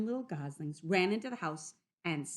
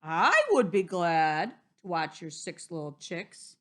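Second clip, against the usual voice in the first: polite wolf offer; 8 dB louder than usual. Acted. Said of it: "coughed politely".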